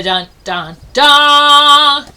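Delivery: dramatic dun dun dun. A narrator's reading. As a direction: sing the suspense sting. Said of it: "This is where we go".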